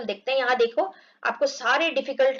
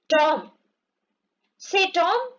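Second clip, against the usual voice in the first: calling out; 5 semitones higher, much slower than usual. Acted. Sid calls Tom.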